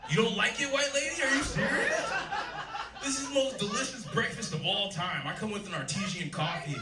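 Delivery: laughing high-pitched taunt to a stranger; gleeful mock outrage. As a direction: crow it out like you can't believe your luck. Acting out a joyful café scene.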